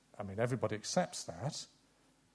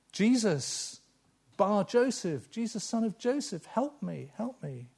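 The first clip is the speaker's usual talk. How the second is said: pleading call; 8 semitones higher than usual. Pretend. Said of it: "what would you cry out and say".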